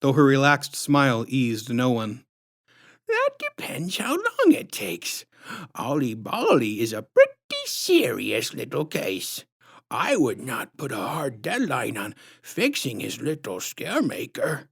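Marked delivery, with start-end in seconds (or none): 3.1-14.7 syrupy clown woman calmly evading the question; creepy clown lady, slow and calm. A narrator's reading. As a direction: soothe with false calm. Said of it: "spoke slowly and calmly".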